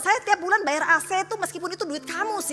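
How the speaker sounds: high-pitched, rapid-fire comic complaint; exasperated protest. Retold or imitated. Wife protesting the AC bill, exasperated. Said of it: "Saya bilang".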